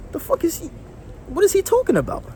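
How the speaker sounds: high, incredulous what-is-he-on squeak; baffled disbelief. Retold or imitated. Colleague thrown off, baffled.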